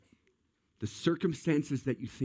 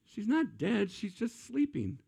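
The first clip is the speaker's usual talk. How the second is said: higher, easygoing voice asking why they cry; casual Jesus; 10 semitones higher than usual. Retold or imitated. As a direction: toss it off lightly, like it's obvious.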